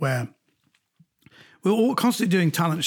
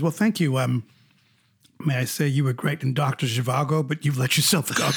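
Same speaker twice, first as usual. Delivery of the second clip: lightly voiced Robert Redford; 3 semitones lower than usual. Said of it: "He goes".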